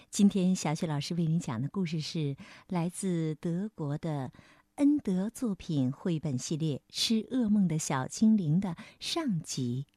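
usual speaking voice

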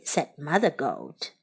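ordinary delivery, nothing marked

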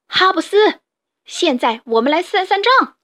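loud, high battle cry; big shout. Acted. The badger leaps onto the rabbit with a loud yell. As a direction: yell his name, then charge in. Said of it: "达尼大叫".

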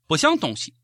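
ordinary talk, nothing marked

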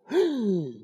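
high, sharp amazed gasp; high awed gasp. Acted. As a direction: gasp at the beauty. Dazzled by green light.

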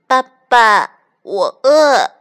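high, loud little duckling plea; hungry duckling. A narrator's reading. Hungry duckling to papa fox, pleading loudly. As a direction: call out plaintively like a baby duck. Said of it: "小鸭子说".

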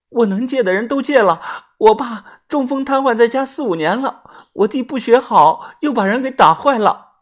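a kneeling woman begs for a loan; pleading. A narrator's reading. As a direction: beg him on your knees. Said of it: "她一脸哀求地说".

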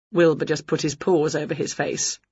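ordinary delivery

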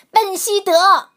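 high, loud angry yell; shouting. A narrator's reading. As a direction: yell at the noisy dog. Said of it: "人们大喊着".

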